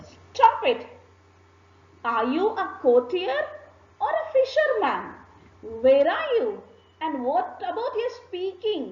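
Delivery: angry king. Acted. King losing his temper at a courtier boasting about fish, shouting furiously. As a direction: snap at the chattering courtiers in rage. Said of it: "the king got very angry".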